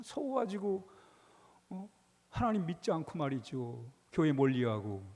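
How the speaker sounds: normal talking voice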